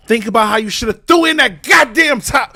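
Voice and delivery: loud, furious rant; heated shout. Real, not acted. Angry viewer ranting at the screen, heated shout. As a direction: blast the character for his choice.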